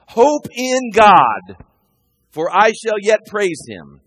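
loud, high and drawn out very slowly; urging his own soul. Acted. David in the psalm ordering his soul to hope, loud and slow. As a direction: stretch it out, commanding your own soul. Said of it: "he's talking to himself".

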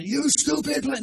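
high, loud, slow insult at a plant; mock yell at plant. Scientists yelling at the mean-talking plant, mock loud yell. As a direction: bark it down at the plant like a bully. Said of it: "they're yelling out".

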